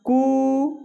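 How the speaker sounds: कु on one beat, the briefest of three lengths; short hrasva vowel. Shown as a demonstration. Teacher showing students the short vowel, one-beat कु. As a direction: keep the उ of कु to one steady beat. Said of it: "ह्रस्व स्वर का उच्चारण कैसे होगा?".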